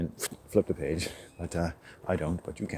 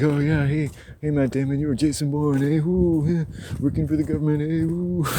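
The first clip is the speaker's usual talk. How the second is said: mocking fan; 8 semitones higher, 6 dB louder than usual. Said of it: "It's like".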